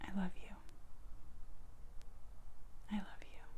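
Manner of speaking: breathy whisper, barely voiced; whispered prayer. Shown as a demonstration. Repeating the forgiveness prayer.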